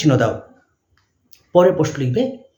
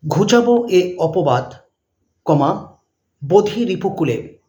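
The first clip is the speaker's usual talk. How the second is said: dictating; slower than usual. Shown as a demonstration. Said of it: "তারপরে লিখবে পরের প্রশ্ন".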